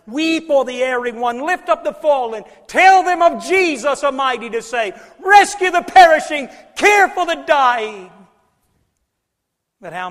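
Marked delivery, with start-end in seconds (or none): 0.1-8.1 loud, high hymn recitation; full-force preaching. Real, not acted. Preacher urging congregation to rescue the perishing, full-force preaching. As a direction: cry the hymn lines out to the congregation.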